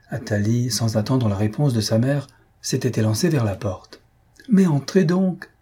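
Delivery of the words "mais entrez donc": high, drawn-out welcoming call; gushing exclamation, pitched high; effusive cry. A narrator's reading. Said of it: "cria-t-elle avec effusion".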